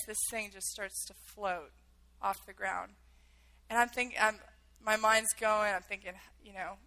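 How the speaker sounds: usual speaking voice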